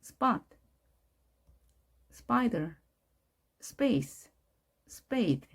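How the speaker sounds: 스팟, 스파이더, 스페이스, 스페이드 with unpuffed p like ㅃ; smooth English p in each word, spaced as a drill; softened P. Shown as a demonstration. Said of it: "좀 더 부드럽게 하는 게 특징이죠".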